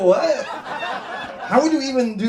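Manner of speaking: high, loud stunned question; shocked squeal. Retold or imitated. Passenger asked for coke by his Waymo, shocked high shout. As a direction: gasp it out in disbelief. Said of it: "I go".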